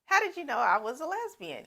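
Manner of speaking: high, loud, stunned "what, how did you know"; shrill acted shock, loud and high; shocked disbelief. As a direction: play her caught completely off guard. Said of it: "And you're like".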